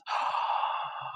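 long audible inhale; deep breath. Acted. Olivia making another secret wish, big deep breath. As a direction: suck air in deeply. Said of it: "she took a deep breath".

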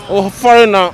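normal talking voice

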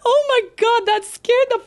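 high, loud, gasping panic with nervous laughs; real scared shriek. Real, not acted. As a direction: squeal it out after a jump scare. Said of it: "That scared the fuck out of me".

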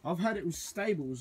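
usual speaking voice

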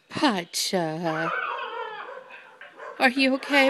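ordinary talk, nothing marked

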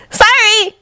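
put-on very high-pitched young ninja apologizing; a squeaky-high sorry; apologetic Naruto. A narrator's reading. Naruto apologizes to Sasuke for interrupting, high-pitched apology. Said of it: "Naruto apologized".

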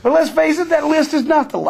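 joking sarcasm before admitting he's kidding; mock sarcasm. Real, not acted. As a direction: tease him with mock-sincere praise. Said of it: "I kid, I jest".

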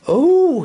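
loud high groan from a stuffed iguana; belly-full moan. A narrator's reading. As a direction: let out an overfed groan. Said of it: "he groaned".

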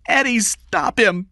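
high, loud urgent appeal; alarmed shout. A narrator's reading. Kathy begs Eddie to stop the beating, shouting. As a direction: cry out for help. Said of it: "Kathy shouted".

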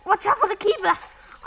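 high-pitched, sudden warning cry; urgent warning. Real, not acted. Urgent warning during the fight.

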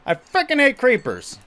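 real gamer frustration shout; exasperated yell. Real, not acted. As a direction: throw frickin' hate out in frustration. Frustrated at creepers in game.

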